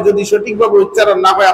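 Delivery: normal talking voice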